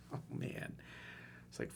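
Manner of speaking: breathy near-whisper aside; soft sigh. Real, not acted. Coach reacting to the text, sighing softly. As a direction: let the words fall out in a sigh. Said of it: "sighing reaction".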